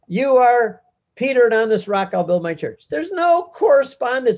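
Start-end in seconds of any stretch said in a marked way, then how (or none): 0.1-2.9 higher and louder, ringing proclamation; proclaimed at Mass. Retold or imitated. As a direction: announce it like a reading at Mass. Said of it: "You hear it at Mass".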